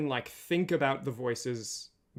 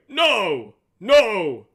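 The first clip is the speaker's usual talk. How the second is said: high, very loud drawn-out cry; loud emotion; 7 semitones higher, 14 dB louder than usual. Shown as a demonstration. Voice actor demonstrates a big "no", high, loud dramatic shout. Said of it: "have a kind of loud emotion".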